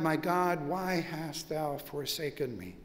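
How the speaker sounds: ordinary talk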